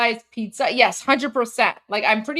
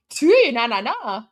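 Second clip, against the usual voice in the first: put-on proud child; 6 semitones higher than usual. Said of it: "I'm like".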